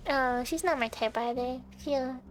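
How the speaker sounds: ordinary talk, nothing marked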